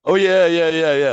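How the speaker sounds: thrilled high yeah yeah; excited yes. Real, not acted. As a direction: gush out an eager yes. Eager answer about seeing kangaroos.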